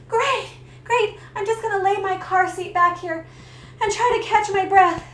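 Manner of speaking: high, loud panicky reply; mock-frantic, high-pitched; acted breathless panic. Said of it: "I can't breathe".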